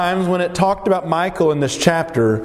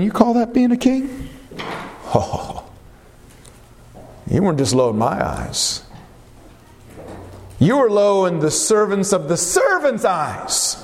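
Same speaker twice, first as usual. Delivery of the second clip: mocking, scornful wife's jab; sarcastic contempt; 4 semitones higher than usual. Bitter wife scolding David for dancing before the Lord, sarcastic. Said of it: "the sarcasm in her voice".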